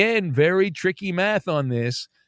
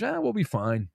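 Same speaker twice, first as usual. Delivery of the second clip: mock unbothered shrug; 4 semitones higher, 6 dB quieter than usual. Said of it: "he's got the body language".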